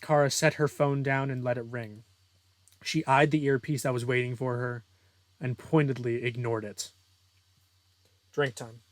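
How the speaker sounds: usual speaking voice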